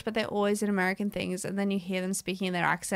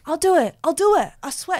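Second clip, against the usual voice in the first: high, eager mock pledge; insistent boyfriend; 9 semitones higher, 7 dB louder than usual. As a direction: plead to be given the chance.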